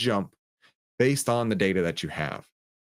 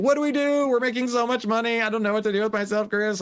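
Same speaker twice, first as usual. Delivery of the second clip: mock chat screaming; 12 semitones higher than usual. Imitating his chat, high mock panic over too much money. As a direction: squeal like a frantic viewer rolling in money. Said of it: "everybody in the chat screaming".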